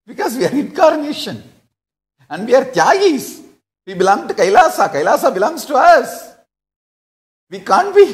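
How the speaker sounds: high, fast, laughing emphasis; excited. Real, not acted. Laughing emphatic preaching to followers.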